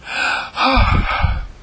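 high unvoiced puff of breath; exhausted exhale; breathless sigh. Shown as a demonstration. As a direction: blow out a long winded breath. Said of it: "Catching my breath".

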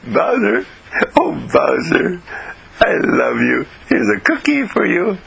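high, slow, gushing affection to the dog; said with love. Acted. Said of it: "you have to say that with love".

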